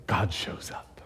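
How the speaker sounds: soft whisper, reverent; awed whisper. Acted. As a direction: let it land in a hush. Climax of the Patmos story.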